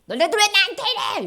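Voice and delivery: fast, high, loud protest; indignant yell. Acted. What Jesus never yelled while beaten, indignant shout. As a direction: snap it out in outrage. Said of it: "이렇게 소리도 안 지르셨어".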